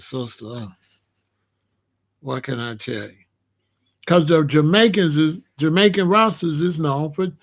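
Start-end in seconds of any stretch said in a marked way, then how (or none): 0.0-3.2 quiet, lower, unsure answer to a sister; soft hesitant reply. Retold or imitated. Answering a woman's awkward question, quiet and unsure. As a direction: drop the voice and stall for words.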